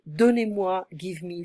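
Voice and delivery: ordinary delivery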